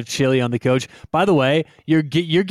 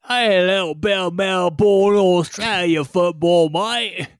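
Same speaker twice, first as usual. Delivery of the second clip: put-on higher, drawn-out Australian accent that slips toward British; bad Australian accent; 3 semitones higher, slower than usual. Co-host pitches the Melbourne Bowl, hammed-up bad Australian accent. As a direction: ham up a botched Australian accent. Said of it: "your British accent, um, that's not Australian".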